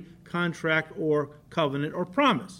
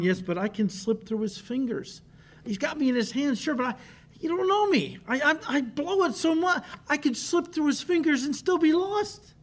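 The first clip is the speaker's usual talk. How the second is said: higher, anxious voice of a worried believer; 7 semitones higher than usual. Acted. Preacher voicing a fearful believer's objection, pitched up and worried. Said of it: "Some Christians would respond".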